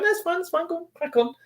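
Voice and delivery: light, high and soft mimicry; high-voiced brush-off. Woman brushing off mask talk in shop, high soft voice. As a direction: wave them on in a high, soft voice. Acts out waving objectors on.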